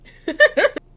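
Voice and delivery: loud, high laugh; burst of laughter. Real, not acted. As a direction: crack up.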